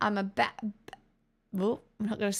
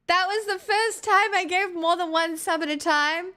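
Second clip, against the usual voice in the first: high put-on voice; 8 semitones higher, 3 dB louder than usual. Mock-distress reading of gifter's message.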